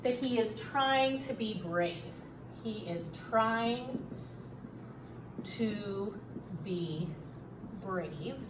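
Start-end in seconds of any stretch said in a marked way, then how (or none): 2.6-8.4 word by word, softer, with long pauses; slow dictation. Shown as a demonstration. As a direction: dictate slowly as you write it out. Teacher repeats it while writing.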